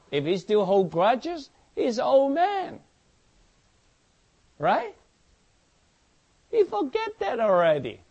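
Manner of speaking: high, loud, rising sermon climax; preaching at full force. Real, not acted. Preacher urging the congregation to forgive, at full force.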